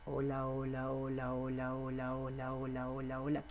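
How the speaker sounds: soft repeated hola. Shown as a demonstration. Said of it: "otra vez".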